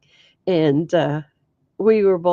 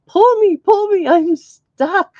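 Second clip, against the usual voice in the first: much higher and louder, a pleading cry for help; mock panicked plea; 11 semitones higher, 8 dB louder than usual. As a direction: yelp it like you're stuck in the mud and can't get out. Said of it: "It's like".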